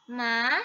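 ma loud, rising; second tone ma. Shown as a demonstration. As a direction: lift ma as second tone. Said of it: "Nada kedua ya". (ma dengan nada kedua)

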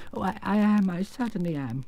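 hesitant, mildly rueful admission; sorrowful. Acted. Shamed little man owning up, sorrowful. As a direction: stammer a regretful agreement. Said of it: "answered the little man sorrowfully".

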